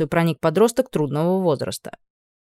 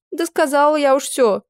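high, tearful complaint; a teen girl, sniffling and sulky; 9 semitones higher, 3 dB louder than usual. Acted. Upset teen girl complaining to the witch, high and tearful. Girl's voice.

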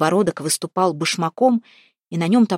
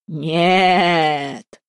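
shrill triumphant squeal; 4 dB louder than usual. A narrator's reading. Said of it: "пронзительно с торжеством взвизгнула".

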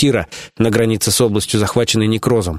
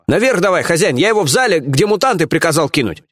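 higher-pitched, obliging henchman voice; eager underling; 7 semitones higher than usual. Acted. Lieutenant leads the boss to the prisoner, eager. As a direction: report keenly to the boss, pitch lifted.

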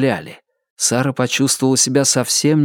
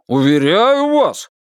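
high, drawn-out assurance; placating shopkeeper, pitched up; protesting proprietor; 7 semitones higher, slower than usual. A narrator's reading. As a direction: insist slowly in a raised voice. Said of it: "начал хозяин".

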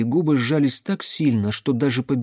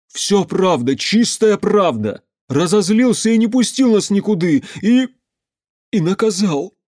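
high, loud, urgent insistence; agitated insistence; 7 semitones higher, 5 dB louder than usual. A narrator's reading. Pyotr answers the doubters, agitated insistence. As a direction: insist on it at a raised pitch.

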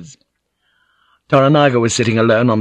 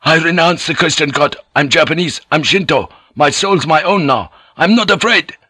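high, forceful renunciation; defiant shout; 8 semitones higher, 4 dB louder than usual. A narrator's reading. Joseph renounces his faith to Alvito, defiant high shout. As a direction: shout it out defiantly, pitch raised, climbing at the end. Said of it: "Joseph shouted".

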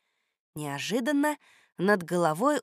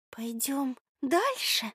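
high, slow, hesitant question; little girl's question; 6 semitones higher, 4 dB quieter, much slower than usual. A narrator's reading. Girl asking friends whether to go on, tentative. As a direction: softly ask in a girl's voice. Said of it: "спросила Люська".

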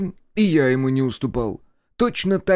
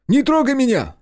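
high, louder panicked yell; frightened shout; 13 semitones higher, 5 dB louder than usual. A narrator's reading. Brother warding off the narrator, high panicked shout. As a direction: shriek it to fend someone off. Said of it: "закричал Колян".

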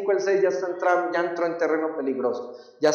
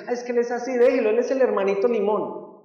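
mock excuse; 5 semitones higher than usual. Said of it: "no es que sea así".

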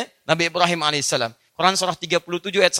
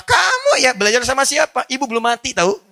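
scolding mother; 6 semitones higher, 5 dB louder than usual. Pretend. Mother scolding her child.

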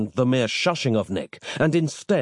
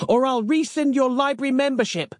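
very high-pitched bellowed threat; 14 semitones higher than usual. A narrator's reading. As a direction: threaten it in a high, strident librarian's voice. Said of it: "bellowed Samantha".

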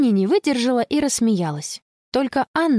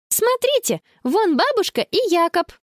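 put-on very high, excited girl; excited exclamation; 11 semitones higher than usual. A narrator's reading. Girl spotting grandmother and brother, excited exclamation. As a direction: call out, pitched way up. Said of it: "воскликнула она".

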